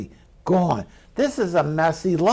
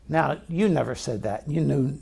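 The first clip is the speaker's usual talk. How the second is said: ordinary talk, nothing marked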